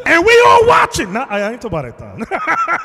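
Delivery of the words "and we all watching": excited. Retold or imitated. Preacher acts out tense moment.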